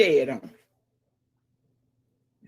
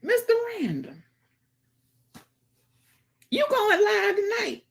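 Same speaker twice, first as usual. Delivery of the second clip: pitched way up, calling out; high calling out; 14 semitones higher than usual. Real, not acted. Streamer asking Mister Random if he's going live, calling high. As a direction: call it up high to the viewer.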